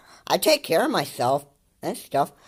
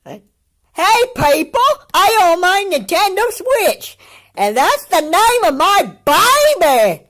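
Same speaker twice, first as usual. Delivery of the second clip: very high, loud silly voice; mock proud announcement; 13 semitones higher, 6 dB louder, slower than usual. Reactor pretending to show off a Switch baby, hammed-up high voice.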